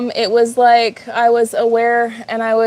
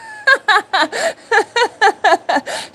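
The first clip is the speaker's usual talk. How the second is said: long, high giggling fit; real helpless laughter; cracking herself up; 11 semitones higher than usual. Real, not acted. Streamer amused by own German accent bit, long high laugh. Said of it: "I'm cracking myself up over here".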